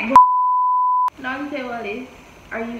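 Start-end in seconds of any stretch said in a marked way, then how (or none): 0.2-1.2 squealing burst of laughter; high laugh. Real, not acted. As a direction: shriek with laughter. Awkward question about being cheated.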